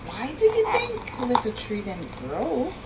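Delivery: ordinary delivery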